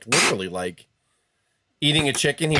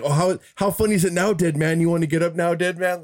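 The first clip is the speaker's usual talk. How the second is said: higher, taunting mock whisper; a hint of a whispered gloat; 5 semitones higher than usual. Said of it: "he would always whisper to him".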